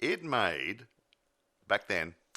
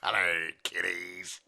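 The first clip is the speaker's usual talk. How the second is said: jack o lantern voice; 3 dB louder, slower than usual. Acted. Co-host echoes the spooky greeting.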